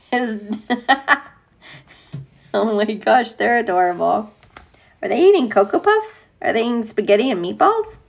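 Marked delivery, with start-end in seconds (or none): none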